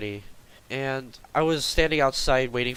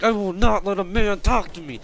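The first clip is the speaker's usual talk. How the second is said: toned-down mock yell, raised pitch; retold yelling; 9 semitones higher, 6 dB louder than usual. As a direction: imitate her yelling without really screaming. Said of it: "yelling at me".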